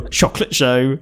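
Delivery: 'Chocolate show' in a hammed-up French school-lesson accent; a mock French accent recalling school French lessons; schoolbook French accent. Shown as a demonstration. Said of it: "I just remembered my French lessons".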